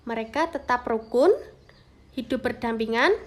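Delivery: ordinary talk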